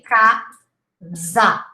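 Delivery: casa slowly as KAH, then za; casa with S voiced as Z; Portuguese Z sound. Shown as a demonstration. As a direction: split casa with a buzzed Z. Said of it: "In Portuguese, we would say".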